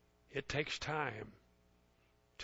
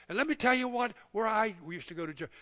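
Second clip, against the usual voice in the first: ordinary delivery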